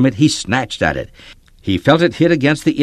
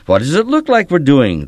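retorted; 6 semitones higher, 5 dB louder than usual. A narrator's reading. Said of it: "the redhead retorted".

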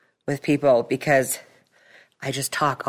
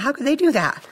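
amazed disbelief; 12 semitones higher than usual. Retold or imitated. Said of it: "and I think".

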